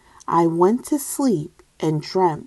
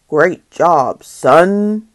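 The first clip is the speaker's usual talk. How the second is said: dad voice; 7 dB louder, slower than usual. Acted. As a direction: praise like a booming frog dad.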